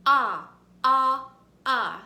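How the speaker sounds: AH said loud as short O in octopus; short O sound. Shown as a demonstration. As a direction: belt out AH like the start of octopus. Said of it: "When I say the short O sound".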